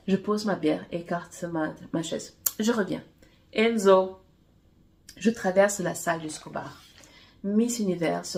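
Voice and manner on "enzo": calling out. A narrator's reading. Friend calling after him.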